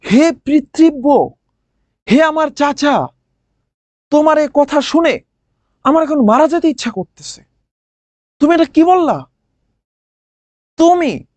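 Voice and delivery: hammed-up near-tears pleading, pitch pushed up; mock tearful plea. Meghnad pleading with his uncle Bibhishan, mock tearful. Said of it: "রাবণী এবার কাতর কণ্ঠে, ওর কান্না পাচ্ছে".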